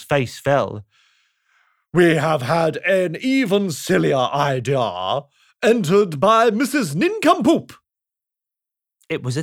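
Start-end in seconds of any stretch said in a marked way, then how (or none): 1.9-7.7 pompous king. Acted. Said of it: "said the king".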